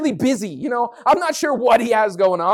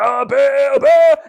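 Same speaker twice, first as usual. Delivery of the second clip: loud mock cry calling out to Baal; yelling for Baal; 4 semitones higher, 7 dB louder, much slower than usual. Baal's priests crying out to their god, loud yell. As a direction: call out to Baal like a desperate worshipper. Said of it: "they start to shout and yell for Baal".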